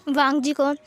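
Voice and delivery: plain reading voice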